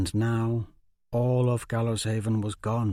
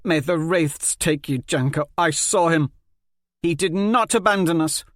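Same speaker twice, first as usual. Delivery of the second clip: loud, higher indignant curse; angry defense; 7 semitones higher, 6 dB louder than usual. Acted. Polly curses Janko and defends Stefan, loud indignant. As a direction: defend him fiercely.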